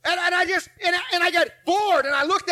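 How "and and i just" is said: normal talking voice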